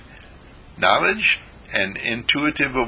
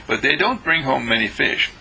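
usual speaking voice

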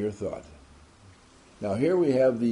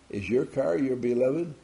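ordinary talk

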